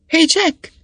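high-pitched call to a mate below. A narrator's reading. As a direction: hail a friend down the shaft. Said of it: "kiáltott lefelé".

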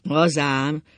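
loud, mocking agreement; sarcastic. A narrator's reading. Ákos answers his wife's simple excuse, sarcastic. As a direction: agree with open scorn, loudly. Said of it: "szólt Ákos gúnyosan".

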